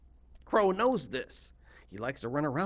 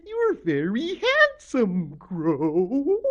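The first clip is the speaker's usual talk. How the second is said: slow, loud, swooping creature voice; flattering octopus lady; 6 semitones higher, 10 dB louder, much slower than usual. A narrator's reading. Octopus Lady tells Crow he's handsome, in a drawn-out creature voice. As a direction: stretch it high and glide down on the name. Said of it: "and she said".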